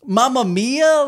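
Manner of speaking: high cartoon Italian cry; playful plumber exclamation; Mario impression. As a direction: squeal it like Mario.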